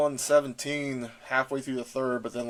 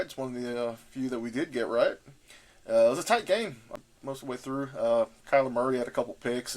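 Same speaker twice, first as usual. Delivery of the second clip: ordinary talk, nothing marked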